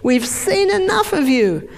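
a stubborn cell shutting the door; the cell slamming the door, high and indignant; defiant cell voice. Acted. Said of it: "The cell's saying".

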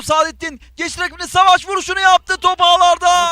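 high, rising goal call; excited football commentator. Real, not acted. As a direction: build up to the goal and shout it.

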